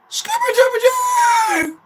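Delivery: very high, drawn-out cartoon howl; Scooby-Doo. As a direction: belt out a squealing dog catchphrase. Imitates cartoon dog's catchphrase.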